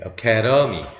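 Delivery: "academy" as uh-KAE-duh-mee; weak first vowel. Shown as a demonstration. Teacher demonstrating unaccented vowels, "academy" starting with schwa. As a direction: say "academy" with stress on the second syllable. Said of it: "대로 발음하지 않고".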